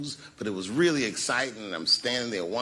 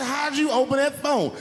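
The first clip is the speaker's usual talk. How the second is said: stunned question; 13 semitones higher than usual. Retold or imitated. Stunned husband asks.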